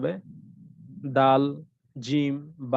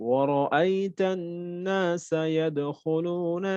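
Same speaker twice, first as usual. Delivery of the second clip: very slow melodic tilawat; Quran recitation; 4 semitones higher, much slower than usual. Shown as a demonstration. Teacher reciting examples to show middle qalqala. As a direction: chant each word slowly and evenly. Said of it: "একটু এখানে practice করি".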